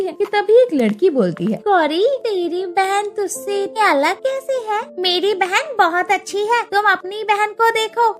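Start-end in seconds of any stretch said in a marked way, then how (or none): 1.7-8.1 high, lightly mocking child; a little girl teasing; taunting girl. (चिढ़ाती बच्ची की आवाज़)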